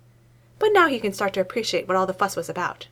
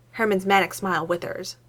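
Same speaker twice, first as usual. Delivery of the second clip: plain reading voice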